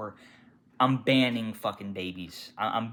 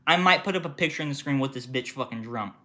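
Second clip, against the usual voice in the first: usual speaking voice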